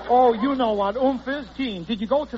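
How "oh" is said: ordinary talk, nothing marked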